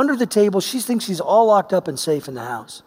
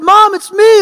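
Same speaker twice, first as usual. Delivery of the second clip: urgent call; 12 semitones higher, 9 dB louder than usual. Retold or imitated. Son calling out to panicking mom.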